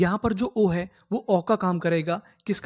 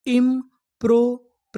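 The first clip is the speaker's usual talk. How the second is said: slow syllables; 3 semitones higher, 4 dB louder, slower than usual. Shown as a demonstration. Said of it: "तो हमारी पूरी meaning क्या बनी?".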